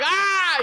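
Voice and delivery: high-pitched mock scream; imitated yell. Buddy's foot through the floorboard on the highway, imitated yell. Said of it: "He's yelling".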